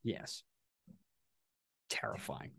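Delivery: breathy dread whisper. Real, not acted.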